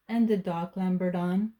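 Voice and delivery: usual speaking voice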